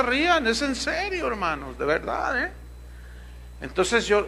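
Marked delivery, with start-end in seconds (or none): none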